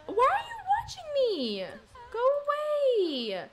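high, loud, laughing shoo-off; annoyed shout. Real, not acted. Streamer yelling at a sim to go away, annoyed shout. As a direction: drive the nosy sim away with a yell.

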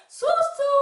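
put-on very high squeaky child answer; puppet child voice. Kid puppet answering teacher in high squeak. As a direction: pipe up brightly like a tiny child.

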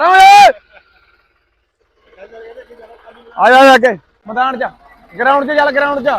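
loud shout across the field; calling out. Real, not acted. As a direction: yell to call him over. Calling the coach onto the ground.

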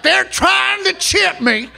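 slow, high mock-paranoid shout; conspiracy guy. Comic quoting a paranoid friend, slow high yell. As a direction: ham up the paranoid friend yelling. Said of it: "he's like".

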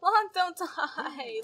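slow, loud wailing plea; drawn-out plea. Real, not acted. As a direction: stretch it out, begging him to live. Pleading as sim burns.

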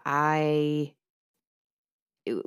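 usual speaking voice

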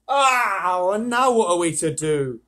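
loud, drawn-out complaining "oh"; exasperated boy. A narrator's reading. Edmund complains to Peter about being lost, exasperated whine.

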